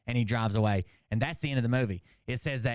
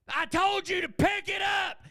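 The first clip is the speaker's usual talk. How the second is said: high, drawn-out angry yell; screamed outburst. Retold or imitated. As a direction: imitate a shop clerk freaking out. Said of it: "he screams".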